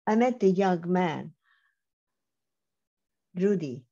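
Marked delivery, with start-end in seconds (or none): none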